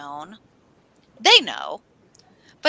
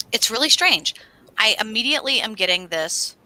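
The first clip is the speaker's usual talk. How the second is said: ordinary talk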